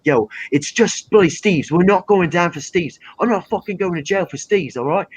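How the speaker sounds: indignant mates in put-on English accent; English street accent. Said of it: "a dirty part of England".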